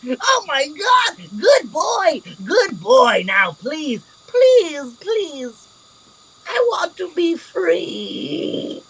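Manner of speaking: high, crying shouted pleas; desperate pleading prisoner. Acted. Captive begging the half-orc to free him, crying high shouts. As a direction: beg with everything, voice cracking high. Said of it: "And he goes".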